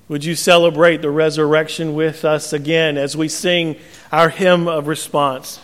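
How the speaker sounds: normal talking voice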